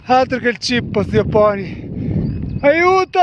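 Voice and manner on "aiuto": mock cry for help. Sees the climb ahead.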